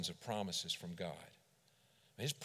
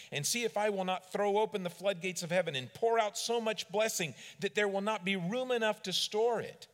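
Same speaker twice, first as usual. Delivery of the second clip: higher and louder, rising fervor; preaching loud; 9 semitones higher, 6 dB louder than usual. Real, not acted. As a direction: proclaim it to the whole room. Preaching scripture at full force.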